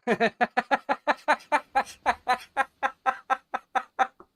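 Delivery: long high cackling laugh; loud laugh. Real, not acted. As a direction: burst out laughing at the email. Reading a funny email on stream.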